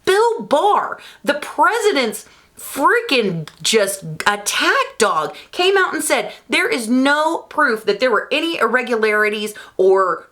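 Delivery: real worked-up, high-pitched rant; heated. Real, not acted. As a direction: argue heatedly, voice climbing high. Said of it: "I'm sorry, I'm heated".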